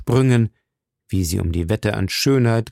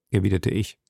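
plain reading voice

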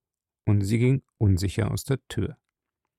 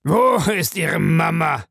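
raised, jeering echo of her question; scornful mimicking; 7 semitones higher, 5 dB louder than usual. A narrator's reading. Man repeats her question about mother, scornfully. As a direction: throw it back at her with a sneer. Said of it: "Wiederholte er höhnisch".

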